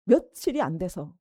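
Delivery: ordinary talk, nothing marked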